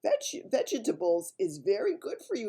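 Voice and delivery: high, eager BFG voice; friendly giant. A narrator's reading. BFG praises the snozcumber to mislead Bloodbottler, high and eager. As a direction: sell the vegetable with bright enthusiasm. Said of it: "he went on".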